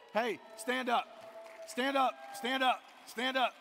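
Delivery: loud, high calls to stand up; calling out. Real, not acted. Pastor calling a member to stand up, loud and insistent. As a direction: rouse him to his feet.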